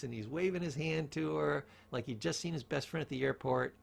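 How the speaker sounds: usual speaking voice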